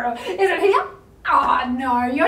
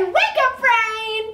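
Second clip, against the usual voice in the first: high, loud wake-up call; 7 semitones higher, 3 dB louder, slower than usual. Pretend. Yoga teacher rousing children's brains, calling out. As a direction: call out brightly to rouse someone.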